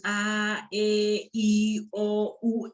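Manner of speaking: "A, E, I, O, U" una por una, con tono parejo; vocales enumeradas. Shown as a demonstration. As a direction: enumera "A, E, I, O, U" separando cada vocal. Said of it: "Cuando tengo las vocales". ("A, E, I, O, U" recitadas vocal por vocal)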